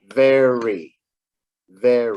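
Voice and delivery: "Very" with a crisp V, teeth on lip, not a W; clear V sound. Shown as a demonstration. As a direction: model "Very", buzzing the V at the start. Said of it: "here's a word with a V".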